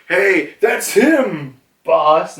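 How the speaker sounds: high, slow goon call; lightly voiced minion pointing him out; background henchman. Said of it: "someone in the background being like".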